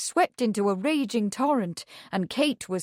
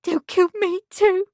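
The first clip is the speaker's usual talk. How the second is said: frightened; 11 semitones higher, 6 dB louder than usual. A narrator's reading. Frightened Meg fears killers.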